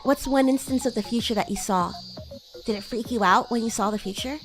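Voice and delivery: normal talking voice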